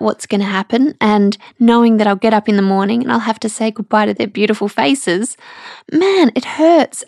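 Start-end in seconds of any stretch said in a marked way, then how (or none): 5.9-7.0 higher and slow, real ache breaking through; choked up. Real, not acted. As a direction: let the hurt crack the voice, slow. Mother leaving her kids, near tears.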